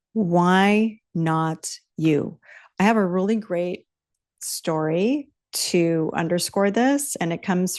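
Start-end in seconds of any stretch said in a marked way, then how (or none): 0.1-2.4 very slow falling repeat. Real, not acted.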